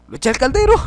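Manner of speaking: high, loud disbelieving question; shocked at what he just did; real high surprise; surprised. Real, not acted. Object vanishes in cauldron.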